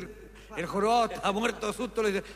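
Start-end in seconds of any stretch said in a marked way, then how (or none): none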